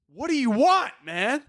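high, exasperated plea. Retold or imitated. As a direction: plead in baffled frustration, pitch jumping up on "want". Retold pleading with his dog.

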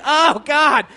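high, loud, flustered panic; mock blind panic in the doorway; hammed-up fluster, fast; panicked.

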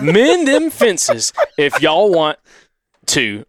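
very slow, high Southern drawl; laboured, drawn-out Southern accent; Southern twang. Acted. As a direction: stretch every word in Alabama twang. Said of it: "trying to do the accent".

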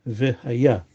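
v'haya with the beat at the end; stress on final syllable. Shown as a demonstration. Teacher correcting to future tense, last syllable stressed in v'haya. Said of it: "that you pronounce v'haya".

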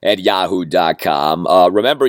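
normal talking voice

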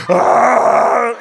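imitated kid's scream, loud and high; acted panicked yell; terrified scream. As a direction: let out a loud, high mock scream of terror. Kid terrified, held by the throat.

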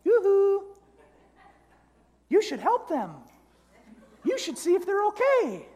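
high falsetto conscience, calling out sweetly; angel on the shoulder. Acted. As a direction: pipe up in a very high singsong voice. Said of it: "you hear the one voice that says".

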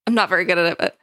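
normal talking voice

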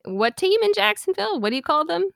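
high, incredulous questioning; teasing quiz. Real, not acted. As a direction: needle them with a high-pitched question. Teasing quiz over mispronounced team.

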